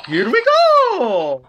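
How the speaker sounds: high, loud drawn-out cartoon cheer; slow-swooping high plumber cry; Mario. Shown as a demonstration. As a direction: belt the mascot catchphrase, stretching the last word. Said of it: "where I can't do it that much".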